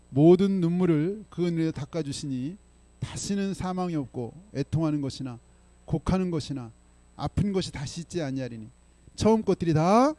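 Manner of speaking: slow, loud solemn recitation; steady, weighty reading aloud; scripture reading. Acted. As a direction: read the verse out to the whole church. Scripture read aloud in church.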